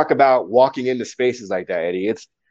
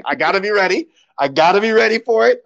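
tense self-warning; 10 semitones higher, 3 dB louder than usual. Retold or imitated. Tense inner talk before expected slur.

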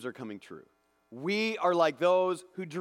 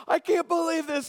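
much higher, louder, thrilled cry; elated, pitched-up exclamation; overjoyed disbelief; 12 semitones higher, 5 dB louder than usual. Retold or imitated. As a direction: cry it out in happy shock. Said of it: "you know, like".

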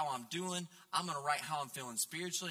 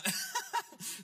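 short high-pitched laugh. Real, not acted. Preacher amused by his own quizzing story, laughing. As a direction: let out a brief, high laugh.

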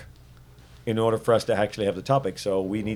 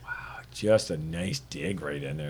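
slow, low, drawn-out mock admiration; dry sarcasm; 6 semitones lower, 6 dB quieter, slower than usual. Real, not acted. Host reacts to a friend's dig, dry sarcasm. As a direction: draw out the praise, low and soft.

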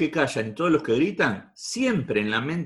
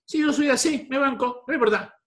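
María's reply; 12 semitones higher than usual. Retold or imitated. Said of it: "te digo, "María, ¿vos te aceptás como sos?".

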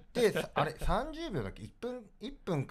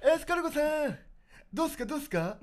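higher teasing taunt; 12 semitones higher, faster than usual. Retold or imitated. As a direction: needle him playfully, voice up high. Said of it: "って言って".

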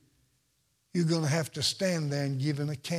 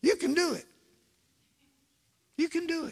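higher coaxing repeat; 10 semitones higher, faster than usual. Shown as a demonstration. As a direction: coax it up high, gently. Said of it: "Look at your neighbor and say".

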